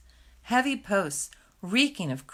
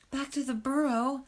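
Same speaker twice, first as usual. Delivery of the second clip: higher, rising, fearful whimper; whimpered question; 7 semitones higher than usual. Acted. Fiver whimpers back at Hazel, a frightened rising question. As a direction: whimper it in disbelief. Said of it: "whimpered Fiver".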